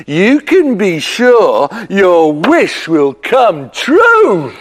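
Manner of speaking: usual speaking voice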